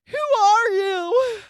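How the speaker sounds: put-on very high, slow, loud quavering old lady; confused grandma impression. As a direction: stretch it out like a lost grandma. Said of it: "That's my impression of grandma".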